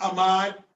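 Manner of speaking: "Ahmad" as a-MAAD, no H sound; wrong Ahmad, H dropped. Shown as a demonstration. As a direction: drop the H and stretch the end of "Ahmad".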